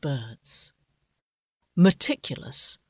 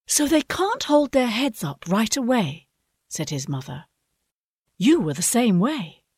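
gentle mother; 7 semitones higher than usual. A narrator's reading. Mother explaining.

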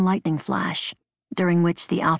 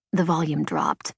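plain reading voice

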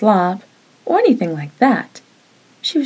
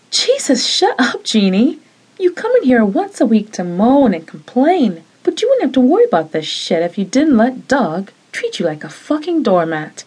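raised, exasperated yelling at her roommate; angry outburst; 5 semitones higher than usual. Acted. Roommate finally snapping at Jeannie, angry outburst. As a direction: let it all out at her, fed up.

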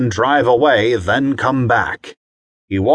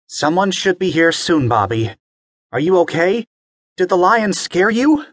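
higher, gently reassuring mother; caring mom; 7 semitones higher than usual. Acted. Mommy checking on Bobby, higher caring voice. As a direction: comfort a child. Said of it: "His mommy".

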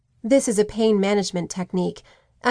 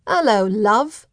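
slow, loud Cockney greeting; Cockney accent; 4 dB louder, slower than usual. A narrator's reading. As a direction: drawl it out in Cockney. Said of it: "a Cockney accent cut through".

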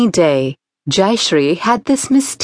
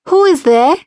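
put-on girl calls out higher and louder; shouted; 9 semitones higher, 4 dB louder than usual. A narrator's reading. Girl calling into the garden, shouting. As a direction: call out across the garden. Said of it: "Jayashree shouted".

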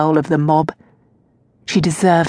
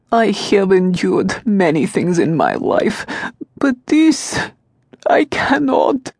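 put-on anguished old servant; slower than usual.

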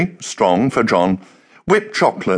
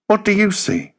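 higher voice for Kirsten asking; Kirsten asking back; 10 semitones higher than usual. A narrator's reading. Kirsten turning the question back on John, higher voice. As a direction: lift it for her question back.

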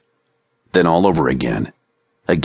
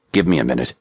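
trembling voice; 4 semitones lower, faster than usual. A narrator's reading. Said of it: "in a trembling voice said".

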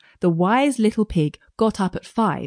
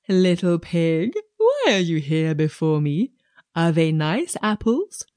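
sly wolf voice; 2 semitones lower than usual. Acted.

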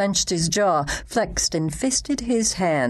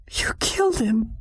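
horrified; 6 semitones higher than usual. Acted. Horrified realisation.

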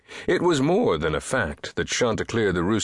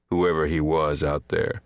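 usual speaking voice

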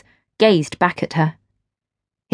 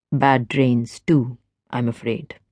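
lower, easy man's voice; smooth stranger; 7 semitones lower, 4 dB quieter, slower than usual. A narrator's reading. Stranger chats to Gwen at the rail, low and easy. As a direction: speak low and relaxed, at ease.

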